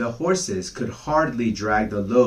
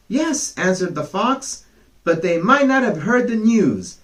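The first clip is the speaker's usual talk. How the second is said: higher, louder hurried reply; hasty fox; 10 semitones higher, 5 dB louder than usual. Acted. Fox excusing himself on the run, hasty. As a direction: blurt it out while running. Fox answering while fleeing.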